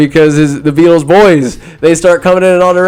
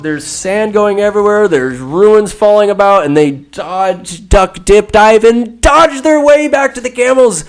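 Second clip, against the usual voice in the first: higher-pitched, building storytelling with drawn-out key words; dramatic action narration; 6 semitones higher than usual. A narrator's reading. Narrating a dodge back to the camels, dramatic and heightened. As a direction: build the escape like a cliffhanger.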